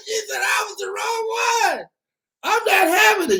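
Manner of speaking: high, laughing, shouted retelling; laughing through it. Real, not acted. Telling the crowd the friend's words, laughing hard and high.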